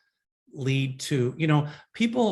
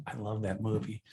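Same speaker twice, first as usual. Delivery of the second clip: ordinary talk, nothing marked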